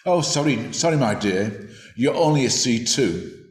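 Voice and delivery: ordinary talk, nothing marked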